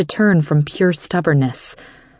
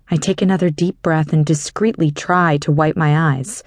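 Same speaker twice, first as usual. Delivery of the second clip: ordinary delivery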